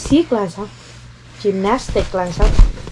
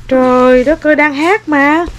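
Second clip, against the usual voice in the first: mom loudly scolding, half laughing; mẹ la lớn; exasperated loud; 6 semitones higher, 5 dB louder, slower than usual. Real, not acted. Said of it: "Đang hát mà ai chơi kiểu đó?".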